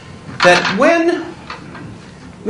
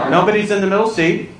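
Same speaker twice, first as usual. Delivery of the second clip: ordinary talk, nothing marked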